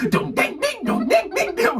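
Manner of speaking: high, ringing dong-ding chimes; music box imitation. Telling friends about a creepy cranked music box, imitating its chimes. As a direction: mimic an old music box. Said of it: "those big old-timey music boxes".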